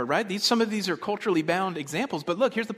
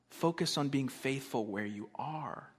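slow, quieter restatement; soft repetition; 6 dB quieter than usual. Real, not acted. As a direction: let it sink in, softly and slowly. Softer repeat of the point.

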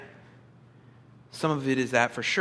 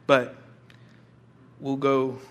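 usual speaking voice

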